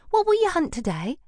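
put-on high, eager girlish question. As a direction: ask it sweetly and eagerly. Llewellyn character voice.

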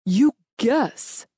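withering, disbelieving demand. A narrator's reading. General challenges his employee's guesswork, withering. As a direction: throw the word back with scorn. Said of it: "he demanded in a withering tone".

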